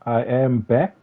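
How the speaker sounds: normal talking voice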